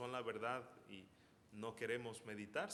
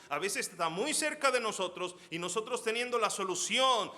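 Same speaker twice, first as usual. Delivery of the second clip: loud, high, impassioned sermon voice; fiery preaching; 7 semitones higher, 9 dB louder than usual. Acted. Pastor urges believers to share the gospel, fiery. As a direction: plead with the congregation, full force.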